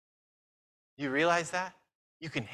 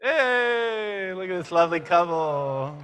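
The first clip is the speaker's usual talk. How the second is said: a higher, bright hey, then gushing; delighted; 4 semitones higher, 3 dB louder, slower than usual. Real, not acted. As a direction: show the couple off to the crowd. Showing off a young couple.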